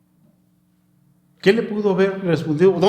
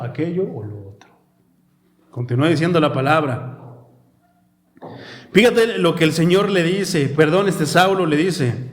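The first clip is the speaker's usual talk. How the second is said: normal talking voice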